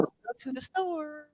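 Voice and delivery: slow, soft, drawn-out Southern drawl; mock Southern drawl. Shown as a demonstration. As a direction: stretch each word long and relaxed. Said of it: "words feel long".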